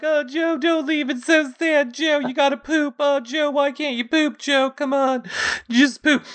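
high, hammed-up wailing plea; mock crying castmates. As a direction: play weepy castmates begging Joe, overdone. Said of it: "everybody starts crying and is like".

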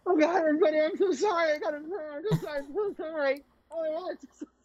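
high, weepy over-the-top sorry; whiny mock apology. Cohost mimicking contestant apologizing to camp, mock sobbing. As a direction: blubber out a fake apology.

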